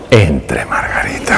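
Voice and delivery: whispered invitation to come in. Retold or imitated. Doctor whispers to his patient, entre Margarita. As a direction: hush it, barely voiced.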